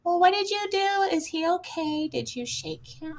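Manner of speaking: mock dispatcher. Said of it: "They're like".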